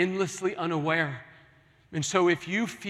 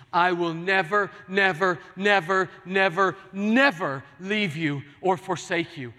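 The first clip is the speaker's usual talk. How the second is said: each NEVER punched louder and higher, building; emphatic repeated never; 3 semitones higher, 5 dB louder than usual. Acted. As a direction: hammer every "never" home, louder each time. Preacher repeats God's promise emphatically.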